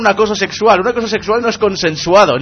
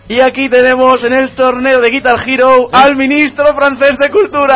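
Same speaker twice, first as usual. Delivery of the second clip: announcer voice; 7 semitones higher, 4 dB louder than usual. Acted. Mock sports presenter.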